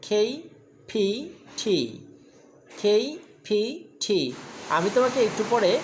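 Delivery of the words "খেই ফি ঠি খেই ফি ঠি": "খেই, ফি, ঠি" said as separate clipped aspirated syllables; sound drill. Shown as a demonstration. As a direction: drill "খেই, ফি, ঠি" one sound at a time with breathy release. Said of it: "এভাবে করব".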